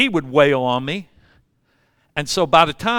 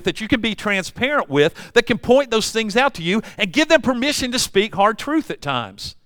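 ordinary talk, nothing marked

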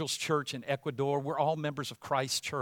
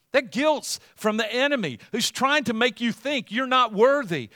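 raised, louder loving plea with pitch lifted high; voice of Jesus; 7 semitones higher, 8 dB louder than usual. Retold or imitated. Jesus assures the guilty believer he is forgiven, in a raised, louder plea. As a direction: plead like a savior freeing a guilty friend. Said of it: "I'm not so sure he said that in that voice".

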